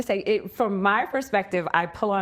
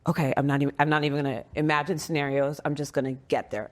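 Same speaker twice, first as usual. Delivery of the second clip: steadying self-talk; 6 semitones lower, faster than usual. Retold or imitated. Friend telling herself to just get there, low and resolute. Retold resolve while setting out to rescue.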